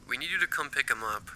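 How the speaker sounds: thin, distant voice through a phone line; woman on the phone. Acted. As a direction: play it as a sharp school caller heard over the phone. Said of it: "The woman on the other end smacked her lips when she talked".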